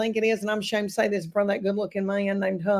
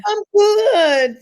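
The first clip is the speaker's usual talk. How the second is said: bright, high, loud hello; excited greeting; 11 semitones higher, 8 dB louder than usual. Real, not acted. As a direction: burst in with delight. Guest's enthusiastic hello.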